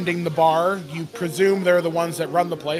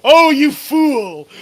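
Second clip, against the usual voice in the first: slow, loud, higher mock scold of a friend; 7 semitones higher, 8 dB louder, slower than usual. Real, not acted. DM teasing a player for his blunder, slow mock scolding. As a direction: drag out the insult, teasing.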